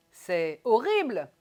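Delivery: very loud, drawn-out "horrible"; slow, exaggerated exclamation peaking on "horrible"; overdone intonation. Shown as a demonstration. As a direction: overdo it, stretching and swooping on "horrible". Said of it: "faites-en trop".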